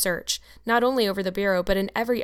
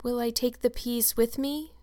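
put-on higher, slow, small child's voice; timid little question, slowly lifted; meek; 4 semitones higher than usual. A narrator's reading. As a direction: ask meekly, treading carefully. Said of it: "said Anne meekly".

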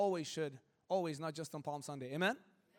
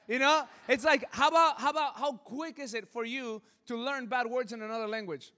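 laughing; 5 semitones higher, 7 dB louder than usual. Real, not acted. Laughing at own story.

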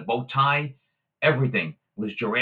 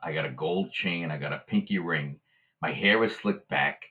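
ordinary talk, nothing marked

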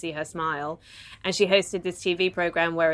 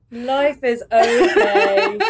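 slow, louder, laughing through it; laughing echo; 5 semitones higher, 7 dB louder, much slower than usual. Real, not acted. Host repeating the punchline, slow laughing echo. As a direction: savour it with a laugh.

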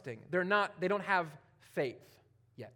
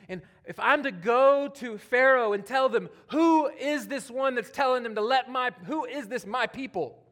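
loud, raised, urgent question; preaching loudly; 6 semitones higher, 7 dB louder than usual. Acted. Preacher voicing Moses' question to God, loud and high. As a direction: call out as Moses would.

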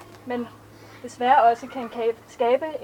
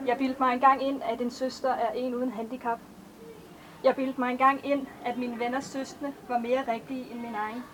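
measured, even poetry recitation; a poet reading her own verses to an audience; poem reading; 4 dB quieter than usual. Acted. Author reads her poems aloud.

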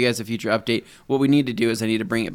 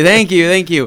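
gracious thanks; 7 semitones higher, 11 dB louder than usual. Pretend.

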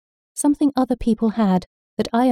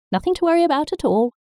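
reassuring grandma; 9 semitones higher, faster than usual. A narrator's reading. Grandma reassures, higher, faster.

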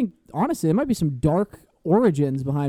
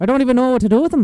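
high, loud, quick fake Irish; hammed-up Irish, rattled off fast; mock Irish accent; 7 semitones higher, 6 dB louder, faster than usual. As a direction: mimic a bad Irish accent.

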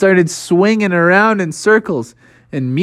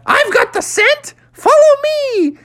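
very high, slow cartoon monkey call; excited monkey voice. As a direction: crow it like a proud little monkey. George leads the hunt.

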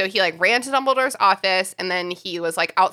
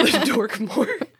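laughing; 4 semitones higher, slower than usual. Real, not acted. Repeats the joke laughing.

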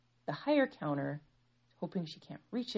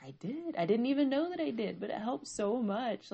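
higher-pitched wondering reply; pleasantly surprised; 7 semitones higher than usual. Retold or imitated. Mom answering her son about the hug, pleasantly surprised. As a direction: marvel at it, retold with lifted pitch. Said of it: "I was surprised".

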